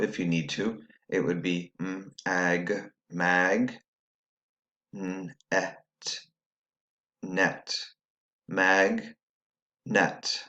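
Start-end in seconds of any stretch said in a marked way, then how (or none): 1.8-10.4 "m, ag, mag, n, et, net" sounded out piece by piece; syllables tapped out. Shown as a demonstration. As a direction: separate each piece of "mag, net" with pauses. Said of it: "tap these syllables out".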